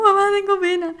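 high-pitched mock plea to mom; imitated plaintive whine. Daughter wanting her mom to hear her cry, high mock-plaintive. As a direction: act out a sad kid calling for mom, high. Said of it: "intento sollozar muy fuerte como para que mi mamá me escuche".

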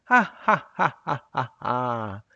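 loud hearty laugh from the lungs; big booming guffaw; belly laugh. Shown as a demonstration. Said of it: "é um tipo de risada, mas bem mais forte".

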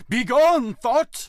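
imitated high, booming banishment; giving it beans. Friend imitating a fellow actor's audition, full-effort shout. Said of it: "actually gave it beans. He put effort into it".

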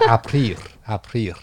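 "aprir，aprir" sounded out like a Latin root; Latin root word. Shown as a demonstration. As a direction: spell out "aprir，aprir" carefully, slow and amused. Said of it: "拉丁文原文是".

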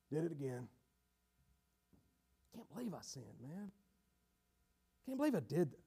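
low, subdued self-reproach; dejected. Acted. Sinner to himself after falling again, dejected. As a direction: downcast and soft, to himself.

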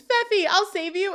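high-pitched heroic cry, played for recap; mock scream. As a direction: call out like a would-be rescuer, hammed up. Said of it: "Eric runs up and screams".

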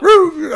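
loud, high whoop; high cheering woo. Real, not acted. Cheering cry.

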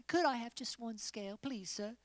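lightly voiced timid small fish, pleading; timid little fish. Pastor imagining the little blue fish begging, a light timid plea. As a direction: ask meekly, like a tiny shy kid. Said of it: "I think of, like".

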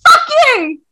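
high, loud yelled curse; mock scream. Book lashing out at its heartbroken reader, mock scream. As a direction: scream it as the book's insult. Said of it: "screamed at me".

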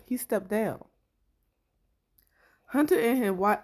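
ordinary talk, nothing marked

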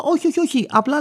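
high, hurried "no, no, no"; flustered denial. Retold or imitated. Driver protests he isn't threatening her, high and flustered. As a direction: backpedal fast, voice jumping up.

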